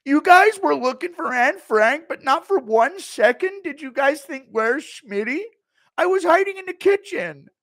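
high, very loud indignant rant; Schmitty rant. Retold or imitated. Streamer as Schmitty scolding partygoers, loud high rant. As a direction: yell it like a wounded ego.

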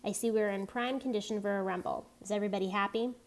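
ordinary delivery, nothing marked

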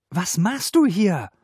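high, loud, slowed angry demand; indignant high-pitched outburst; furious. A narrator's reading. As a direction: confront the intruder angrily. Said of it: "Wütend riss sie die Tür".

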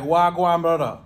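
lightly put-on Jamaican accent; mock Jamaican patois. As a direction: play a Jamaican mate hollering hello. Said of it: "And Damien's like".

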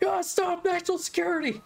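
high, frantic shouted cop voice; imitating a yell. Retelling a movie scene, imitating the cop's high yell. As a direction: yell it like a panicked rookie cop. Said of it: "Steve Zahn just yells".

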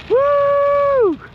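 a loud, high whoop of delight; excited whoop. Real, not acted. Pilot cheering his plane's pass, a loud high whoop. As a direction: cheer the jet as it screams past.

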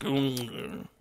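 usual speaking voice

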